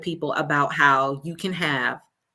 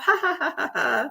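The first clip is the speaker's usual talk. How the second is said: high mock "ha, ha, ha" imitating coworkers; put-on high laugh; mock laughter; 10 semitones higher than usual. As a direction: imitate people laughing it off, starting high and dropping. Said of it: "they would laugh".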